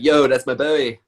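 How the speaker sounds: teasing impression of a Americanised friend; Louis impression. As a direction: imitate a friend's put-on American slang. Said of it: "he basically does one anyway".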